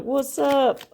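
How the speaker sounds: high Southern "What's up?"; Southern accent. Shown as a demonstration. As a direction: toss off a Southern greeting. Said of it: "Did I just go Southern there again?".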